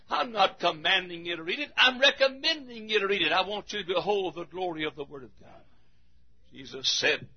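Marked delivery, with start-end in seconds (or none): none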